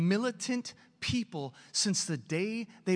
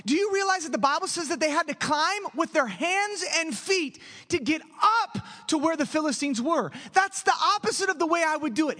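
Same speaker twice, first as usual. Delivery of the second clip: higher and louder, driving the point home; fired-up preaching; 7 semitones higher, 4 dB louder than usual. Acted. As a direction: preach it with rising heat. Preaching builds, mocking cautious plan.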